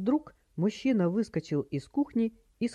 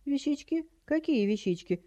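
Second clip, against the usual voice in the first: put-on high, puzzled batty old lady; high doddery questioning; playing dumb; 10 semitones higher than usual. A narrator's reading. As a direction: feign blank confusion as a dotty granny. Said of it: "придуривалась тётя Витя".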